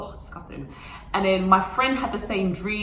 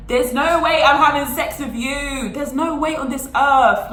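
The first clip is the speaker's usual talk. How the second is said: louder, higher firm refusal; indignant refusal; 7 semitones higher, 5 dB louder than usual. Retold or imitated. Refusing the boyfriend on the bus, loud and indignant. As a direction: shut him down hard. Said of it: "I said".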